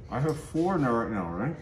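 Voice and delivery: talking round a mouthful; words muffled by marshmallows; mouth full. Real, not acted.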